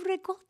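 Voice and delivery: high, softly imitated Italian "Ricotta"; imitated Italian pronunciation. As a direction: say "Ricotta" Italian-style with a firm T. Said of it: "She goes".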